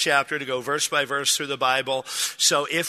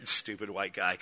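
low, softer dismissive aside after a chuckle; retold amused scorn, pitched down; smirking contempt; 8 semitones lower, 5 dB quieter than usual. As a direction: think it at him with a smile. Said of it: "she kinda smiled and was like".

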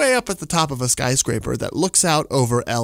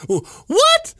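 shrill falsetto burst of disbelief, much higher and louder; incredulous squeak; 4 dB louder than usual. Real, not acted. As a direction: squeak it like you can't believe the dream. Disbelief at his own dream.

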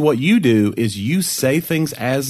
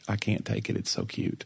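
soft gushing; 2 semitones lower, 12 dB quieter than usual. Real, not acted. Gushing over gray dog.

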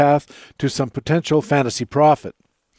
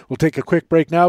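normal talking voice

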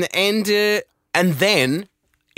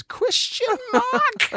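slow, much higher deadpan query; Jason Bateman question mark; much slower than usual. Retold or imitated. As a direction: stretch the question mark out high. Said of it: "He says things and then goes".

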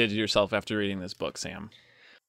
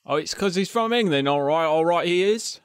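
higher-pitched mock English accent; bad English accent; 4 semitones higher than usual. Co-host teases Sam about being from England, in a bad English accent. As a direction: echo him in a hammed-up English accent. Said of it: "two very bad English accents".

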